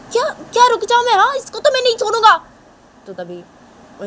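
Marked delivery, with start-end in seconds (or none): 0.1-2.4 put-on much higher, louder, fast girl's voice; a furious daughter refusing to stop; angry girl. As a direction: Tisha shouting fast in a high voice. Girl Tisha refuses angrily.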